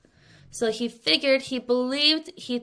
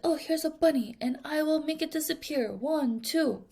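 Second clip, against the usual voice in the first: higher, hammed-up magician showing off a bunny; 6 semitones higher, 4 dB quieter than usual. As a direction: play a stage magician doing a trick. Magician voiced in the example.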